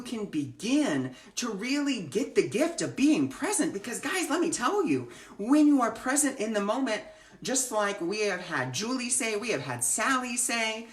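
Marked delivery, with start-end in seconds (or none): none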